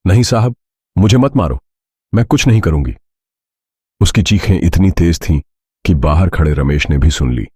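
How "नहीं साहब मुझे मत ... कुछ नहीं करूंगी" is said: higher-pitched mock cries of panic; feigned screaming. A narrator's reading. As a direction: plead in a raised, put-on cry. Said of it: "जानबूझकर जोर से चिल्लाना शुरू किया". (ऊँची आवाज़ में डर का नाटक करते हुए चिल्लाना)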